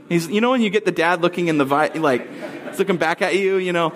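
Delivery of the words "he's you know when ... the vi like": ordinary delivery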